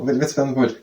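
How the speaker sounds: ordinary delivery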